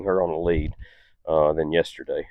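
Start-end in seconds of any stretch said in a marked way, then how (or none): none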